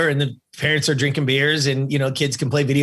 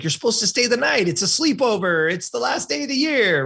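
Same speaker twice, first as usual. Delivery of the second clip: high-pitched imitation of mom objecting; mock a mother's sing-out protest, pitched high; 9 semitones higher than usual. Mom objecting to leaving the sleepover, high mock protest.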